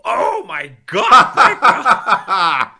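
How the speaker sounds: loud, slow, laughing relief; overjoyed shout. Real, not acted. Host cheers loudly as cohost names the movie. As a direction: cry out in delighted relief.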